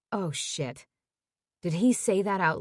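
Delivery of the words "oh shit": usual speaking voice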